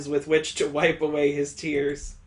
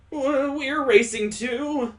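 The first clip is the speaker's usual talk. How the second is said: put-on girlish character voice; 8 semitones higher, slower than usual. Girl character joins race.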